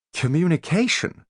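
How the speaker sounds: high incredulous scoff at the word; scornful disbelieving echo. Acted. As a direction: sneer the question back up high. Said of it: "scoffed young Master Fowl".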